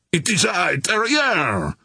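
high, urgent call to hold fire; shouted. A narrator's reading. Officer identifying himself to an armed sailor, shouting. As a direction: call out who you are, pitched well up. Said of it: "he shouted".

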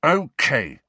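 loud, high, hard agreement; aggressive. A narrator's reading. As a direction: agree as a challenge. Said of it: "Harry said aggressively".

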